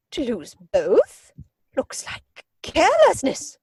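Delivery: slow on "to lose both", then a high scornful lift; withering put-down. Acted. Lady Bracknell scolding Jack for losing his parents, withering scorn. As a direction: savour the insult, drawing it out.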